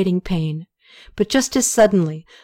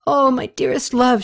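cried out; 5 semitones higher than usual. A narrator's reading. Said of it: "she cried out".

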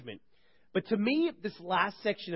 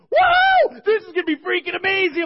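huge high whoop, then shouting; mock kid excitement, very high and loud; imitated thrilled kid; 11 semitones higher, 11 dB louder than usual. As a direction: holler it like a kid on a rollercoaster. Youngest kids thrilled as plane shakes.

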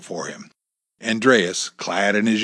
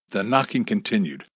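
plain reading voice

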